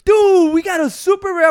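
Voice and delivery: high, loud bragging about a rare card; hammed-up thrill over a card pull; thrilled kid. Kids excited over pull.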